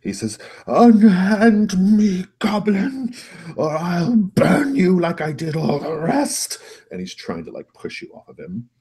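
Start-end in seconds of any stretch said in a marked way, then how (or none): 0.7-6.6 high, loud, slow wail of threat; outraged master. Acted. Master grabbed by his goblin, shrieking threat. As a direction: cry out in outrage, drawing out each word.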